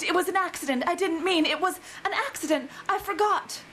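high, flustered pleading; begging. A narrator's reading. Reader voicing romance heroine apologizing to Nikos, begging. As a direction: pitch it up, pleading an excuse. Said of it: "she begged".

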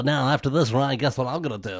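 ordinary delivery

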